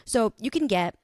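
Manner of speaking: normal talking voice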